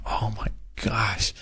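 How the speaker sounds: slow, breathless whisper of relief; whispered awe. Retold or imitated. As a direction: whisper it as if finally understood. Stunned relief at the nurse's words.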